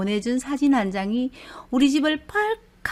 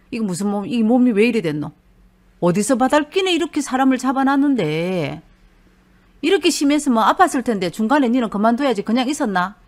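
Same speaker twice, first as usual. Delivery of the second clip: louder, fast, exasperated scolding in dialect; scolding husband; 5 dB louder, faster than usual. Retold or imitated. Husband scolding his bruised wife, loud and fast. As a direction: tell her off for putting up with it.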